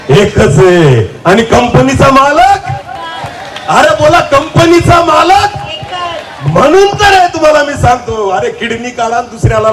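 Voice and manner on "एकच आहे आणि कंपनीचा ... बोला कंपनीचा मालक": slow, stretched call to the crowd; drawn-out call. Acted. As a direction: stretch every word for the crowd to answer. (सावकाश ओढलेला आवाज)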